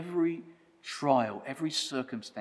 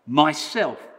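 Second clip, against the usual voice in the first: ordinary delivery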